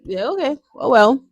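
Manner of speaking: offhand mock resignation; acted shrug-off. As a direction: shrug it off lightly. Acts out shrugging off hunger.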